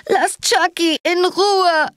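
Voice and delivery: put-on high, drawn-out child's wail; slow, high-pitched howling child voice. A narrator's reading. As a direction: cry it out like an upset little kid. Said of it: "hörte ich ein Kind heulen".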